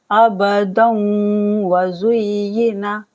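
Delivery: अबदन व with the nun merged into wa with a hum; slow recitation with ghunna; nasal merge. Shown as a demonstration. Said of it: "गुन्ने के साथ मिला के इदगाम करेंगे".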